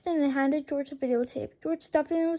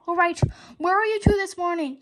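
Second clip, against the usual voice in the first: louder growling demand; growled; 4 semitones higher, 7 dB louder than usual. A narrator's reading. Principal growling at the boys. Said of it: "he growled".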